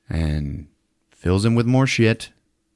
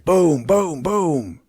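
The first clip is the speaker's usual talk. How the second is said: mortar booms; 9 semitones higher, much slower than usual. Retold or imitated. Imitating mortar fire.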